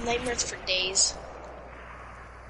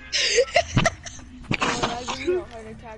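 laughing. Real, not acted. Laughing at friend's scare.

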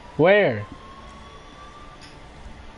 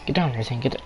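ordinary talk, nothing marked

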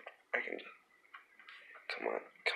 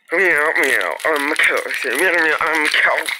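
loud silly toy voice; 6 semitones higher, 12 dB louder than usual. Pretend.

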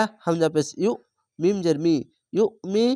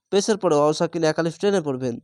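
normal talking voice

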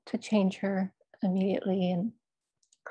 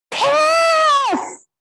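a huge, much higher, much louder yell; bellowed; 12 dB louder than usual. Retold or imitated. Mother insisting on the commode, bellowing. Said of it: "she bellowed".

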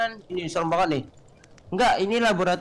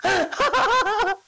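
high laughter; 14 semitones higher than usual. Real, not acted. Laughing after escape.

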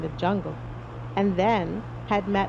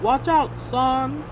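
high, slow warning call; captain's warning cry; 4 semitones higher, 4 dB louder, slower than usual. A narrator's reading. Captain warns Barnaby of danger, raised drawn-out call. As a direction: call out a warning, stretching the words. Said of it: "cried the captain".

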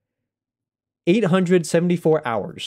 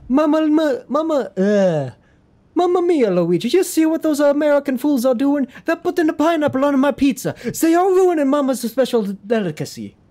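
very high, outraged cartoon Italian; 11 semitones higher, 3 dB louder than usual. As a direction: rant like a scandalized stage Italian. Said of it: "I'll just go for the stereotypical".

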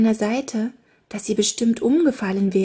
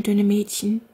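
plain reading voice

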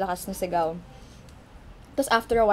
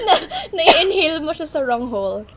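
laughing, higher and louder; 7 semitones higher, 10 dB louder than usual. Real, not acted. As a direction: laugh through it. Laughing while explaining.

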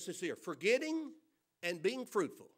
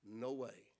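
a much lower, softer curt no; mock flat refusal; 10 semitones lower, 10 dB quieter than usual. Imagined Joseph refusing hungry Egyptians, low quiet refusal. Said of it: "I'm gonna say".

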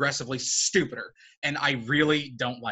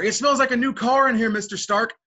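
put-on higher, louder, goofy kid voice; mocking Peter Parker; 7 semitones higher, 5 dB louder than usual. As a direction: ape a naive, eager teenager. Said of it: "like Infinity War".

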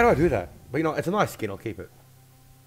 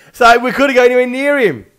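excited; 10 semitones higher, 11 dB louder than usual. Real, not acted. Still excited from laughing.

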